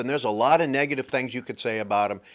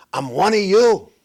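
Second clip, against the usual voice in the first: louder, proud mock Harris line: I'm one of you; mock proud claim; 4 semitones higher, 5 dB louder than usual. Mocking Harris to his audience, louder and proud. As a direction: belt out a fake claim of belonging. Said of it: "She decided to say".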